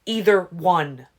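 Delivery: loud, slow insistent shout. A narrator's reading. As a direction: hammer every word. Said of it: "I was shouting again".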